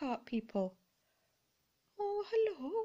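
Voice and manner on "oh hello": high cooing greeting; cooing hello. Pretend. Greeting the little boy figurine, cooing high. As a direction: coo a hello to a doll.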